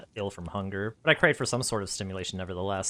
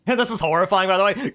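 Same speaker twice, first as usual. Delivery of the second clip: gleeful aside; 10 semitones higher, 8 dB louder than usual. Real, not acted. Reader breaks off, laughing aside.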